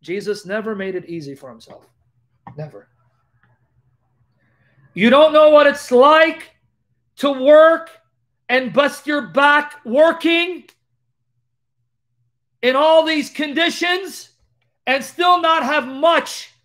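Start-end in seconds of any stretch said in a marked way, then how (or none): 4.9-16.5 high, loud preaching build; full-force pulpit preaching; fiery preaching. Acted. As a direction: preach it hard to the crowd.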